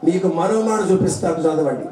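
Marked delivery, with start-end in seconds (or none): none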